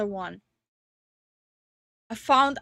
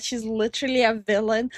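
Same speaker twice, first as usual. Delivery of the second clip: ordinary delivery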